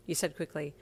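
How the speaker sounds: ordinary delivery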